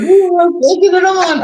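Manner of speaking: very high squeaky mock wife voice. Husband teasing his absent wife with a very high mock voice. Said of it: "That's not her voice".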